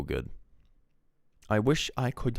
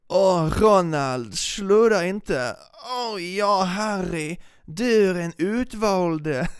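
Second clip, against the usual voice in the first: high, loud, slow southern Swedish cartoon voice; southern Swedish parody dub; 12 semitones higher, 5 dB louder, much slower than usual. Mocking the Swedish Harry Potter parody dub in southern Swedish accent. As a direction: ham up a southern Swedish Hermione-style whine. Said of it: "like, southern Swedish".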